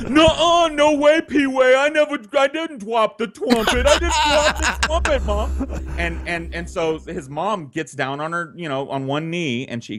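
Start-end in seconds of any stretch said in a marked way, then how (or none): 0.1-5.8 put-on very high, loud childish denial; hammed-up whiny kid who can't say his R's; protesting little boy. Little Sean swears to Mom he didn't drop it, frantic.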